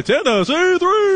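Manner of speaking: high, drawn-out arena announcer call; stadium announcer. Retold or imitated. The voice of Neyland Stadium calling a three, drawn-out announcer call. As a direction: stretch it out like a basketball PA announcer. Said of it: "he's the voice of Neyland Stadium".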